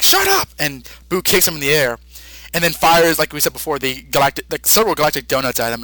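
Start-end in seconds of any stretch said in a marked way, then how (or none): 0.0-0.5 sudden high shouted outburst; mock yell. Gohan yelling at Buu to shut up, high mock shout. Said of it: "Gohan's like".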